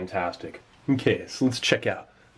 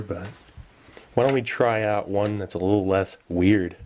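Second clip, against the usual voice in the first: ordinary talk, nothing marked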